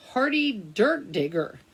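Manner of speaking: plain reading voice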